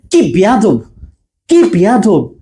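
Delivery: long, emphatic কি before বেয়াদব; drawn-out intensifier. Shown as a demonstration. Teacher acts out scolding a rude boy, long emphatic কি. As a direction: stretch কি in কি বেয়াদব. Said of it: "বেয়াদবকে কিন্তু বাড়িয়ে দিচ্ছে কি".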